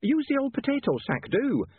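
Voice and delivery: put-on high-pitched, fussing older landlady; flustered landlady. A narrator's reading. Landlady helping lodger carry the weights, flustered. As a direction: urge him on, pitched well up. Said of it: "now quite flustered by events".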